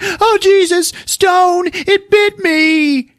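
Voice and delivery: very high hysterical woman, hammed up; imitating a terrified victim; mock panicked shriek. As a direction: wail it high, dragging the ending. Acts Kim bitten, panicking.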